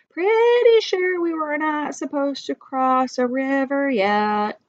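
plain reading voice

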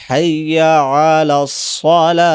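হাইয়া আলাস সালাহ said slowly and loud; teacher demonstrating the adhan words; careful demonstration. Shown as a demonstration. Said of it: "দেখেন".

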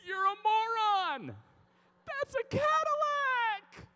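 high, drawn-out shout after a runaway dog; loud, high-pitched exasperated holler; exasperated yelling. Retold or imitated. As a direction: yell it at full volume, stretching the words.

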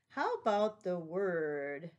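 ordinary delivery

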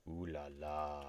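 a touch of mock French charm; lightly faked French accent. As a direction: toss it off like a cliché Frenchman. Joking about trip to France.

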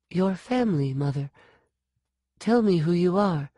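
put-on high, urgent girl's voice; pleading girl. A narrator's reading. Sean pleading with the woman, high. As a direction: beg her to answer.

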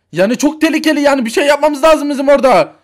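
loud, high, fast alarm; panicked. Acted. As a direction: rush it out in a panic. Father panics at doctor's finding. (panikle, hızlı hızlı bağırarak)

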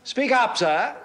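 loud, high courtroom demand; commanding demand. Acted. Counsel pressing a reluctant witness in court, loud command. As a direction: raise your voice sharply to order the witness.

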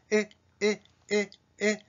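"え" as in いいえ, jaw held still; short lax i. Shown as a demonstration. Teacher drilling the English vowel, "え" between i and e. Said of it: "このいいえのえのつもりの".

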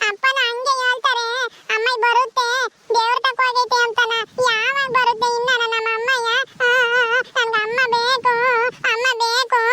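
put-on high-pitched tearful child, words stretched out; crying little boy. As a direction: wail out the complaint like a small child. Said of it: "ಅಳ್ಬಾರ್ದು, ಅಳ್ಬಾರ್ದು".

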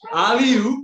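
"Aliu" called out high and loud; southern Italian dialect. Shown as a demonstration. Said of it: "But in the dialect, they'd say".

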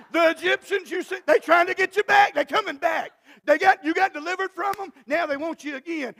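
loud, high, shouted preaching climax; preaching at full force. Real, not acted. Pastor rousing the congregation, shouting loud. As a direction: build it up to a roaring sermon peak.

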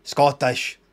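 put-on echoed Scottish accent from the clip; imitated Scottish accent. Doubting the actor talks so, imitated Scottish accent. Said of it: "he speaks like that".

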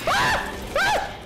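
high, sharp screams of fright; real terrified high screaming; panicked screams. Real, not acted. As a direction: scream as it lunges. Monster in his face.

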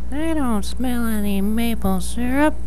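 put-on very high baby mole voice, slowing down; tiny baby mole, drawing the last words out. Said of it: "Baby Mole said".

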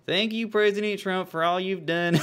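high, hammed-up southern voice; bad southern accent. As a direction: thank him in a put-on Southern twang. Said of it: "My southern accent is just, uh, fucking Dale Gribble".

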